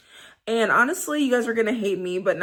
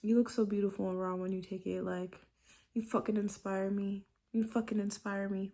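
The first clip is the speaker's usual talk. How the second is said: much softer, intimate murmur; soft loving dirty talk; 9 dB quieter than usual. Shown as a demonstration. Showing the loving talk she prefers, softly. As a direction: breathe it close to a lover's ear. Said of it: "Why don't you just be like".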